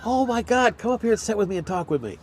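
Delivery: eager, pulling a friend over; high quick delighted welcome; excited invitation. Retold or imitated. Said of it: "And he goes".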